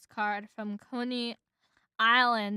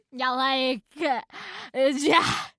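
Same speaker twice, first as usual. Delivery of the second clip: loud, broken-up bee impression; attempted movie-line impression; 2 semitones higher, 6 dB louder, slower than usual. Shown as a demonstration. Reader fumbles the film bee's line, loudly. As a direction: blurt it loudly, tripping before jazz. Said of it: "trying to do a good impression of it".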